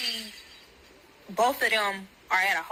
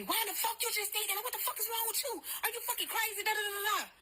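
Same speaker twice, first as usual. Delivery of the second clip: very high-pitched imitation of his yelling; mock yelling; 12 semitones higher, 7 dB quieter, faster than usual. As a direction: mimic his frantic yelling high, without real volume. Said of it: "So he yelling at me".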